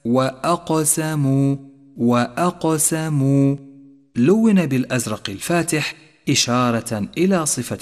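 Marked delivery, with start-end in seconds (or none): 0.1-3.6 وأقسموا chanted slowly with a bouncing echo on ق; qalqalah. Shown as a demonstration. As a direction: bounce the قاف in وأقسموا, drawn out. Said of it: "لاحظ صفة القلقلة لحرف القاف". («وأقسموا» بقلقلة القاف)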